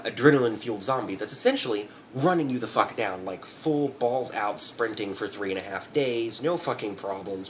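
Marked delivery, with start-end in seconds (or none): none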